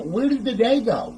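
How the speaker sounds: high-pitched mock disbelief; acted bewilderment. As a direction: play baffled at lost time. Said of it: "You're sitting here going like".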